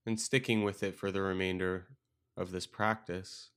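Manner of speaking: calm meditation guide with long, restful pauses; guided meditation. Acted. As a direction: guide them gently into stillness, leaving space between phrases.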